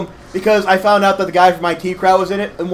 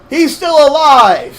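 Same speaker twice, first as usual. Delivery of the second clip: loud, high, drawn-out cry of alarm; alarmed shout; 8 semitones higher, 6 dB louder, much slower than usual. Real, not acted. Startled by enemy still alive in game.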